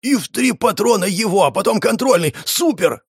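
high-pitched, excited rattle; admiring gabble. A narrator's reading. Shumov recounting the fight, admiring gabble. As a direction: gush over every shot, high and eager. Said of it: "восхищённо зачастил Шумов".

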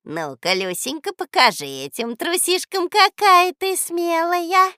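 rising, swelling into high sing-song; wheedle, drawing out the end; coaxing old woman. A narrator's reading. Old woman coaxing the girl to go first, wheedling.

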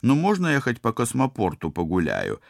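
put-on high, wheedling girl's plea; pleading. A narrator's reading. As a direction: beg the captain for permission. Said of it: "взмолилась она".

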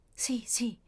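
breathless "Sì, sì" whispered; barely a breath, dying. Acted. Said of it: "mormorò lei".

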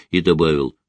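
usual speaking voice

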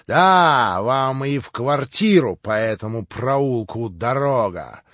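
громко и выше; отрежь навсегда; гневная отповедь. A narrator's reading. Иван разрывает с братом. (гневно прогоняя брата)

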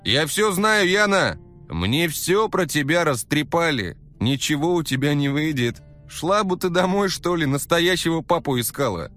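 loud, high shaming rant; shaming scold. Acted. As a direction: scold her loudly. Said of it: "начал стыдить её Валера".

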